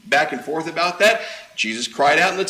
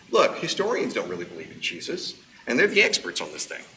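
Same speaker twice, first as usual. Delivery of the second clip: low dismissive skeptic; 9 semitones lower, 6 dB quieter than usual. Retold or imitated. Voicing modern skeptic.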